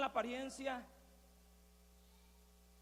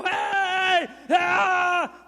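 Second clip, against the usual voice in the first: high, long holler and scream; noisy yelling; 6 semitones higher, 17 dB louder than usual. Acted. Blind man causing a scandal first, full-force yells. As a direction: make a loud racket to be noticed. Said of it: "hacía un escándalo primero".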